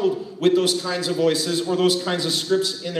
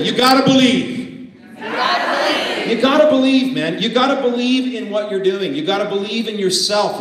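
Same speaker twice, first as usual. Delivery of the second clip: preacher-style call to the room; soulful call; 5 semitones higher, 5 dB louder than usual. Shown as a demonstration. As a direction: lead the crowd in a soulful chant. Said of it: "Say it with me".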